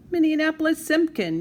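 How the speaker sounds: high, loud full-name scolding call; mom yelling. Acted. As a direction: bellow the child's full name. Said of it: "yelled Mom".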